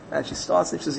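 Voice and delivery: ordinary delivery, nothing marked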